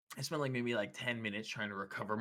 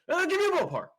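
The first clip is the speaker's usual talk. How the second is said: mimicking a loud caller, pitched way up; really loud caller; 9 dB louder, faster than usual. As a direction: yell it like the pushy guy on the phone. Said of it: "he was talking really loud".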